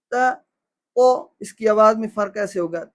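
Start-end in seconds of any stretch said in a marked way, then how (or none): 0.1-0.3 "تا" with light t, open a; short, thin "تا". Shown as a demonstration. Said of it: "ایک کی آواز باریک ہے". 1.0-1.1 "تو" with heavy emphatic t; short, rounded, full "تو"; thick to. Shown as a demonstration. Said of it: "ایک کی موٹی ہے".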